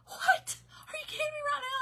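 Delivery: high, crying astonished retort; tearful disbelief. Retold or imitated. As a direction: blurt it in shock through tears. Retold shock at news, while tearful.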